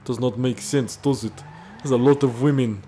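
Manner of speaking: hammed-up Russian accent; Vladimir Putin. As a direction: put on a heavy, comic Russian accent. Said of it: "trying to do a Russian accent".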